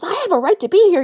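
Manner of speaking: aggressive tone. Retold or imitated. Man in the washroom confronting the speaker, aggressive and loud. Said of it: "in this aggressive tone".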